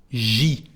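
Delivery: "j'y" as one syllable "zhee"; "j'y" contracted with no vowel after the J; contraction. Shown as a demonstration. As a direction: merge je and i into "j'y". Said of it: "contraction between je and i".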